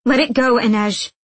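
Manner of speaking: loud, high plea to drop it; urgent interruption. A narrator's reading. Nina stopping her friend's questions, urgent.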